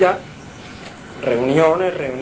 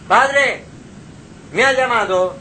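put-on young Samuel asking; 5 semitones higher than usual. Boy Samuel wakes, asks father.

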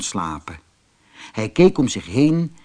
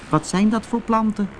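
curious question; 8 semitones higher than usual. Acted. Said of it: "vroeg Manus aan de vogel".

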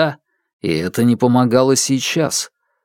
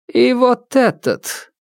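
higher, slow and commanding; stern widow; 11 semitones higher, slower than usual. Acted. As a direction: pick the next victim, unhurried. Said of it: "Палец ... указал на другого".

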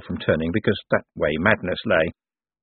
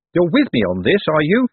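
raised, higher voice; said loudly; 7 semitones higher, 4 dB louder than usual. A narrator's reading. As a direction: check firmly that he's listening. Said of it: "said Moist loudly".

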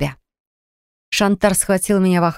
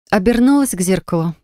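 ordinary delivery, nothing marked

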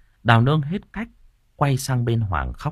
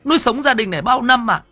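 very high-pitched outraged wife's cry; shrieked reproach, wounded and furious; near screaming. A narrator's reading. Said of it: "bà nói như hét". (nói như hét, phẫn uất)

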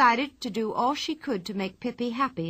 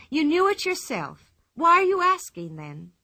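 higher and louder indignant question; 8 semitones higher, 6 dB louder than usual. A narrator's reading. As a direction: toss the question back at the teacher. Pippi challenging teacher.

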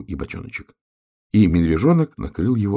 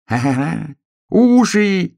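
acted ha-ha-ha, rising to high shout; roaring with laughter; 12 semitones higher, 7 dB louder, slower than usual. A narrator's reading. Hedgehog tickled by hare's ears, loud guffaw. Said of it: "захохотал ёжик".

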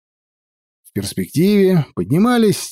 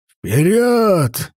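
sharp raised bark of an order; barked command; 6 semitones higher than usual. Acted. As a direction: bark it at the troops. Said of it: "гаркнул Лидул".

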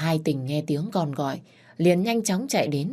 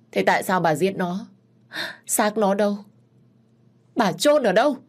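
rising desperate demand; 4 semitones higher than usual. Acted. Mother demands where her daughter is buried, rising to a cry. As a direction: push each question harder, desperate.

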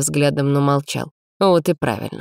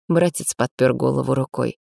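ordinary delivery

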